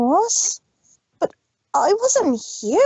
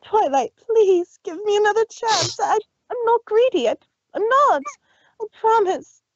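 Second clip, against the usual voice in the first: high, pleading, near tears; 7 semitones higher than usual. Acted. Rarity begging Twilight for another chance, high and pleading. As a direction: beg her desperately.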